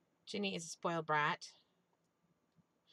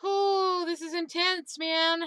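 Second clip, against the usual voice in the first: loud, high excited outburst; 10 semitones higher, 11 dB louder, slower than usual. Real, not acted. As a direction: blurt it out, thrilled and tense. Sudden intense fight.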